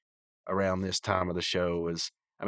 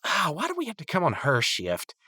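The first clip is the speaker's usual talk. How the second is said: lightly imitated higher-pitched whine; whiny complaint; 9 semitones higher than usual. Rigby to Mordecai about her shift, mock whine. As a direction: moan like a put-upon cartoon character.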